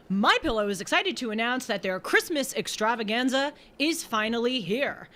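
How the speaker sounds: upbeat announcer ad read; lightly peppy ad voice. Acted. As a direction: sell it like a radio spot. Reads ad copy on air.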